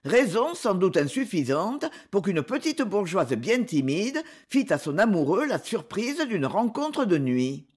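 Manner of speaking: ordinary delivery, nothing marked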